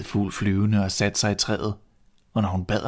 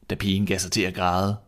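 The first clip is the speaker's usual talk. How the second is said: ordinary delivery, nothing marked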